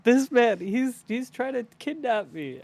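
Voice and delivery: high cry for help, loud at first; mock alarm. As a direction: accuse him in pretend panic. Child character publicly accuses sheriff.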